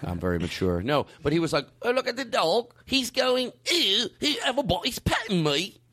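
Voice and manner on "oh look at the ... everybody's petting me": high-pitched, hammed-up Cockney dog voice; Cockney accent. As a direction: voice a dog in bad mock Cockney.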